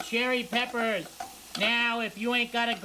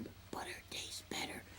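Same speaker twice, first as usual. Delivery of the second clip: whispered aside; 19 dB quieter than usual. Real, not acted. Cook confides to viewers that butter wins, whispering. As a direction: whisper it like a secret.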